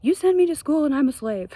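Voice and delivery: son's protest, loud and high; upset son. Retold or imitated. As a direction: complain like a fed-up kid. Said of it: "the thing that tends to come up is".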